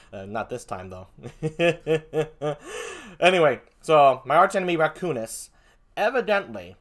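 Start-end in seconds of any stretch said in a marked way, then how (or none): 1.2-3.0 laugh. Real, not acted. Laugh at own aside.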